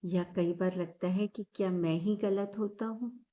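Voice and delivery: calm, measured meditation guide with long pauses; guided meditation. Acted. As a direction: lead listeners gently through each breath, leaving space after every phrase. (शांत, ठहराव भरी ध्यान कराने वाली आवाज़)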